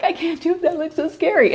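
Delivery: high, small voice of nervous pie students; mock scared students. Teacher imitating freaked-out students in her pie class, high and mock-scared. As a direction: play the panicky student who can't do it. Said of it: "They're like".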